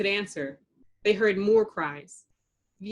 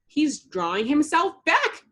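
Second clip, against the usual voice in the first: higher, louder dismayed shout; alarmed cry; 6 semitones higher, 4 dB louder than usual. A narrator's reading. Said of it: "cried Buttercup".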